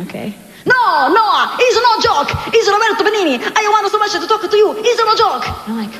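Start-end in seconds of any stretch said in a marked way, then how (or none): 0.6-5.4 high, insistent Italian voice, pleading to be believed; Roberto Benigni. Retold or imitated. Italian director insisting to the singer it's no prank, frantic impression. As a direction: protest excitedly in a heavy Italian accent. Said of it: "That's the way he talks".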